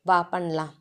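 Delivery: soft seductive invitation; intimate murmur. Acted. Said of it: "செல்லமாக கடிக்க".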